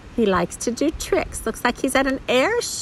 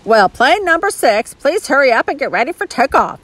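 louder, raised announcer voice, hurrying at the end; loudspeaker announcement; 6 semitones higher, 5 dB louder, faster than usual. A narrator's reading. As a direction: call it out as a rapid airfield announcement. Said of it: "said a voice over the loudspeaker".

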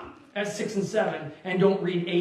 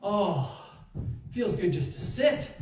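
relieved sigh; 5 dB quieter, slower than usual. Retold or imitated. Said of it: "And I just remember going".

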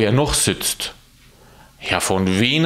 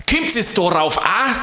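angry yell; 9 semitones higher than usual. Acted. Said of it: "schrie er".

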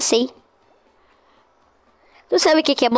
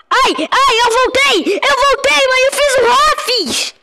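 high excited cry; 9 semitones higher than usual. Real, not acted. Excited after coming back in game.

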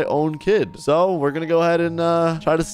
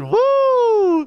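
put-on kid cheer; 4 dB louder than usual. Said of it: "He said".

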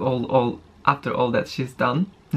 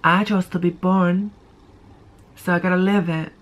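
usual speaking voice

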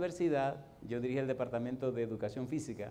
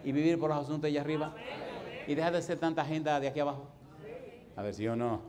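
louder, driving sermon questions; preaching appeal; 5 semitones higher, 5 dB louder than usual. Acted. Pastor challenging the congregation to live for God, urgent preaching. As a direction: press the crowd with each question.

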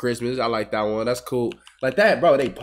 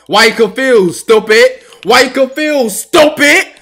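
loud taunting; 8 semitones higher, 9 dB louder, slower than usual. Real, not acted.

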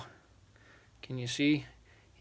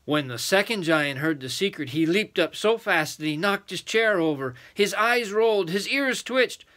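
storytelling building higher and louder; excited narration; 6 semitones higher, 5 dB louder than usual. A narrator's reading. Adult reading the giant's fright, excited building narration.

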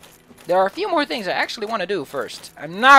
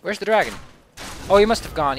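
ordinary talk

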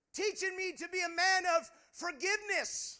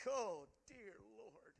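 dismayed groan; 7 semitones lower, 12 dB quieter, slower than usual. Retold or imitated. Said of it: "And I'm like".

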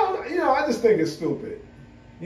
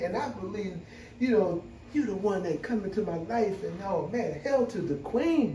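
softer, smooth pimp-style pickup talk; pimp-style pickup line; 7 dB quieter than usual. Retold or imitated. Friends putting on the act for a woman, smooth and soft. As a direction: ham up the smooth player charm. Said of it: "they're like".

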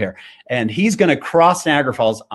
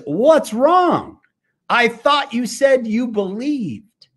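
high, slow mock reproach; high, slow teasing showman; showman's reproach; 9 semitones higher, much slower than usual. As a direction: call their bluff. Showman calling the crowd out.

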